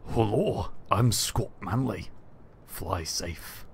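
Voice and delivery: put-on a weak try at a Scottish accent, slow; badly faked Scottish accent imitating Scott Manley; failed Scottish accent sign-off; attempted Scottish accent. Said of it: "I can't do an accent".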